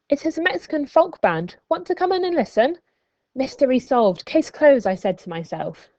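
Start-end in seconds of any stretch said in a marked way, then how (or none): 0.1-2.8 put-on cheerful girl voice. Maria voiced, cheerful invite. 3.3-4.9 triumphant. Acted. Triumphant self-talk.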